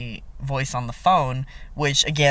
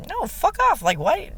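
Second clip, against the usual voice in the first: sudden much higher, sharp brush-off; exasperated outburst. Retold or imitated. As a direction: fling it off in disbelief. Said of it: "I would've just been like".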